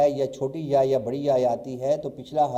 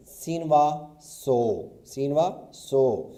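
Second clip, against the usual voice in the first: very slow, louder: سینوا سو drawn out; slow spelling out; 3 dB louder, much slower than usual. Shown as a demonstration. Teacher to students, slowly spelling سو. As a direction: spell each letter slowly as you write. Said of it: "دیکھیں کہ میں لکھتا ہوں".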